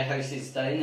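ordinary delivery

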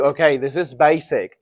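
ordinary talk

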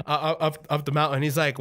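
ordinary talk, nothing marked

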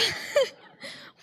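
high airy burst of laughter; real amused laugh; breathy laugh. Real, not acted. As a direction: laugh at your own joke. Laughing at own post.

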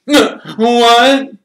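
high, loud jolt of alarm; loud startled cry. Acted. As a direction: shout it out in shock. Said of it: "he said loudly".

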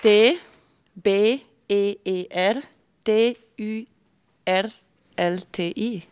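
letter by letter, high and slow; spelling it out. Real, not acted. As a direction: spell each letter out with pauses. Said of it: "wie würdest du Erdbeertörtli buchstabieren".

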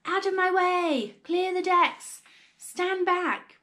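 high, loud drawn-out holler; bellowed warning, pitched high; shouting. A narrator's reading. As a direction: shout to clear everyone out of the way.

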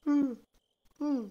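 high, loud, slow dopey voice; mocking Ashley. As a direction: play her as clueless, high and loud. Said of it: "You just, like, standing around".

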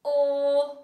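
loud, held puppet-character voice; Super Fox. Shown as a demonstration. Teacher speaking in her puppet's voice, loud and sustained. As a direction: belt out the vowel as a puppet hero. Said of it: "Can we say it in Super Fox's voice?".

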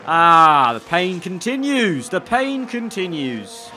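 loud cry, then high drawn-out lament; theatrical, long-suffering lament; dramatic anguish. Real, not acted. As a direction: wail it slowly, pitch swooping up and falling. Lamenting a penalty in the race.

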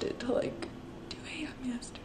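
sheepish whispered admission; whispered confession. Real, not acted. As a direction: whisper it like a guilty secret. Confessing a late binge night.

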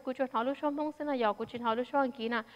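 switches into Hmong with its own timbre and lilt; a brief spoken line in Hmong; speaking Hmong. Shown as a demonstration. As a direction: let the Hmong words flow naturally in their own voice. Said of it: "I only hear the Hmong underlying my words".

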